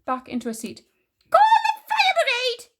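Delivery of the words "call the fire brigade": very high, loud panicked shriek; sudden shout. A narrator's reading. Grandma with her stomach burning, sudden high shout. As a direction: shriek it out for help. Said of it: "she shouted suddenly".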